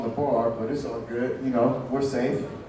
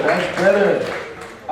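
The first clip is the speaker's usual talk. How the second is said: drawn out, slow; comic savoring the crowd's reply, slowly; slow repeat; much slower than usual. Real, not acted. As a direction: stretch it out slowly.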